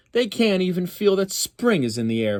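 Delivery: high, scornful jab. Acted. Phyllis scorning her brothers to Uncle Phil, high scornful. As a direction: sneer at the lazy brothers. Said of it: "Phyllis said scornfully".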